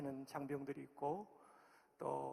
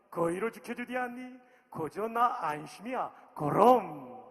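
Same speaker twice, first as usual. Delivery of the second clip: loud, higher Pyongan dialect reading; 4 semitones higher, 9 dB louder than usual. Shown as a demonstration. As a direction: declaim the psalm in a booming Pyongan accent. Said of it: "이제 평안도 버전입니다".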